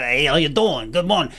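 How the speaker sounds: put-on light New York accent for a friendly spider. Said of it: "I don't know why he had a New York accent".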